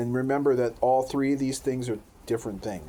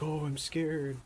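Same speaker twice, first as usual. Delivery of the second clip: imitating scared daughter; 8 semitones higher, slower than usual. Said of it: "she's like".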